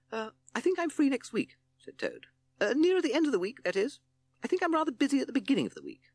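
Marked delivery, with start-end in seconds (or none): 0.1-1.6 very high, hesitant toad voice; evasive toad. A narrator's reading. Toad putting off the night's plan, evasive. 2.6-6.1 very high, fast toad voice; evasive toad. A narrator's reading. Toad postponing until the end of the week, evasive.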